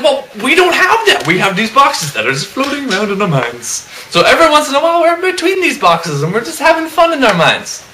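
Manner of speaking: high-pitched, whiny comic protest; exasperated rant. Acted. Man defending his empty mind, exasperated. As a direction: protest in a squeaky, indignant voice.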